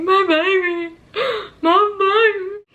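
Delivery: high, tearful, slowing sobbed words; crying. Retold or imitated. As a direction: weep with joy over the baby. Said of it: "I started crying away".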